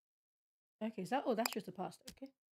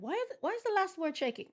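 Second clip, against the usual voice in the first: pitched up high in disbelief; exasperated disbelief; 12 semitones higher than usual. Real, not acted. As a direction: ask it like you can't believe your eyes. Exasperated at shaking text.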